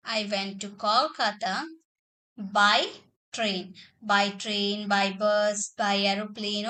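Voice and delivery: slow, word-by-word dictation with long pauses; a teacher dictating each word slowly. Shown as a demonstration. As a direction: read it out slowly so students can write it down. Teacher dictates example sentence.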